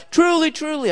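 "Truly, truly" jumping up high; emphatic high "Truly, truly". Shown as a demonstration. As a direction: hit "Truly, truly" hard and high. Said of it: "It's for emphasis".

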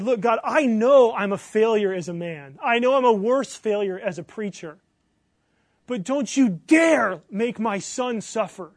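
loud, impassioned retold confrontation with God; forceful protest, slowing hard on the warning; [name]'s anguished anger. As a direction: challenge God as a desperate father. Retold father's angry prayer to God.

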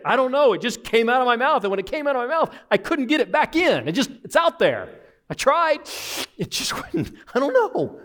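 high, hammed-up helpless protest; flustered excuse. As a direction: play a kid caught out and pleading ignorance. Child making excuses, acted.

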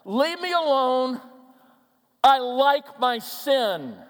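acted stubborn refusal; defiant answer. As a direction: push them away, loudly and slowly. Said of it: "could have been something like this".

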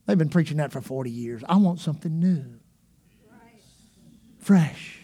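low, bored, dismissive drone; jaded. Retold or imitated. Jaded churchgoer waving off the sermon, bored. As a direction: brush it all off, bored. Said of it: "people that are jaded are like".

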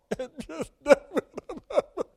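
high mocking laugh; scornful laugh. Real, not acted. Laughing at Saul's fake tears.

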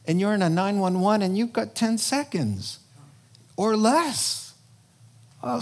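ordinary talk, nothing marked

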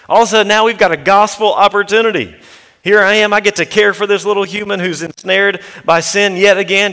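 preacher rousing the congregation, full force; fervent preaching. Acted. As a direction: build up to a passionate sermon climax. Sermon builds to full force.

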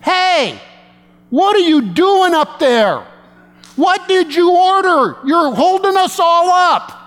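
high-pitched irate driver hollering; loud yell. Retold or imitated. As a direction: holler impatiently at the car ahead. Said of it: "I mean, it was loud".

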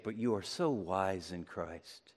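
low, soft mock admiration; sarcastic. Paul contrasting fools with the so-wise Corinthians, quietly sarcastic. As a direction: praise them sarcastically under your breath. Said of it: "Paul gets really sarcastic here".

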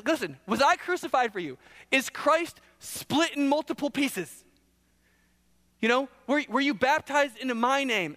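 pointed sarcastic questioning; intentional sarcasm. Acted. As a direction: pile up absurd questions sarcastically. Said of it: "it's intentional sarcasm".

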